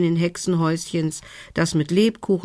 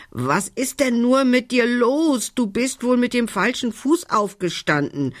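krächzender Rabe; 8 semitones higher than usual. A narrator's reading. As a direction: croak it high, as a scolding raven. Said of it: "krächzte er". (krächzende Rabenstimme, hoch)

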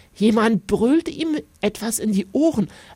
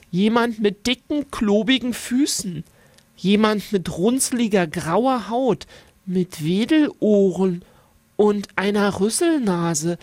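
plain reading voice

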